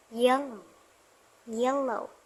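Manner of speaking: "Yellow" with the first syllable stressed. Shown as a demonstration. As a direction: say "Yellow" clearly, weight on "Yel". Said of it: "The stress in syllable yell".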